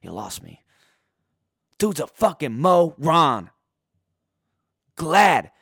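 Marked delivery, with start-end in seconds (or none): 0.0-0.6 low, quiet, confused mumble; dopey Haskins impression. Mocking Haskins dodging a reporter's question, low dazed mumble. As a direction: play the clueless quarterback dodging a reporter.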